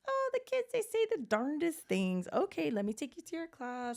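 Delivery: high, singsong brush-off; sweet older lady. Retold or imitated. Preschool director brushing off toddler's insult, high imitated lady voice. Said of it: "And then she goes".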